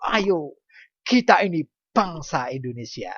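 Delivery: rally the class like a proud patriot; spirited recital. Shown as a demonstration. Teacher demonstrating a spirited poem recital, mildly rousing.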